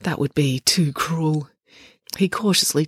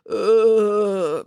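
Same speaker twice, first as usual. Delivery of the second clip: long, drawn-out groan; groggy, sustained "uh"; dazed moan; 2 semitones higher than usual. A narrator's reading. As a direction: moan as if concussed. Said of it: "moaned Coach Voss".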